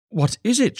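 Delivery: sudden high startled cry; a wife gasping out a question in alarm; cried out. A narrator's reading. Wife startled at the threshold, crying out high. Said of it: "she cried".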